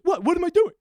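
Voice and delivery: high, loud put-on protest; mock innocence. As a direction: play dumb in a taunting high voice. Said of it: "You were mocking me".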